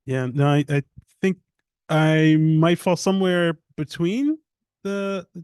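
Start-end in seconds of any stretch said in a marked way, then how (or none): none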